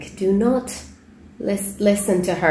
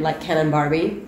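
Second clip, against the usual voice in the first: usual speaking voice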